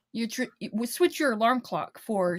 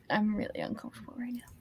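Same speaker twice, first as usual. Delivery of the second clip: whispering; 4 semitones lower, 11 dB quieter, faster than usual. Real, not acted. Freaked out by prim Alan.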